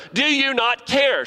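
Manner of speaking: high, accusing complaint; frustrated Martha. Retold or imitated. Martha accusing Jesus mid-teaching, high and whiny. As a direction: demand it, frustrated. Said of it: "she marches up in frustration".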